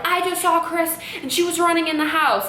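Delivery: hammed-up brother snitching; tattling brother. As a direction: mimic a sneaky kid telling on her. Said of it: "be like".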